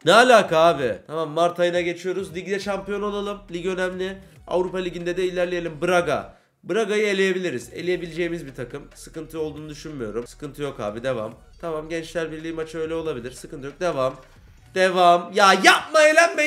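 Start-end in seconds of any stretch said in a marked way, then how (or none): 0.0-1.0 loud exasperated "what the hell"; sudden loud frustrated cry at the screen; frustrated outburst. Real, not acted. Frustrated outburst at game result. 14.8-16.5 loud, higher, begging the game not to lose; loud high-pitched plea to the team; exasperated pleading. Real, not acted. Panicking over cup elimination.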